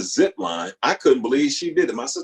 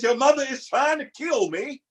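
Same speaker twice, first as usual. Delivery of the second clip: much higher, aggrieved wail of the father; father's complaint; 13 semitones higher than usual. Retold or imitated. Father telling his daughter his wife wants him dead, high wail. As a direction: whine that the wife is out to get him. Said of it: "He says".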